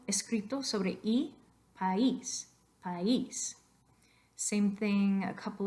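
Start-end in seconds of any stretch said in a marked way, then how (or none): 1.8-3.6 "país" broken in two, stressed on the í; two-syllable PAÍS. Shown as a demonstration. Teacher breaks up the diphthong, "país" in two syllables. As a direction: say "país" as pa-EES. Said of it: "so you have to break it up".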